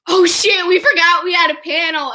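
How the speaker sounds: high mock dismay, a bit loud; alarmed realization. Twins remember their panel, mock alarmed realization. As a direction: blurt it as it dawns on you.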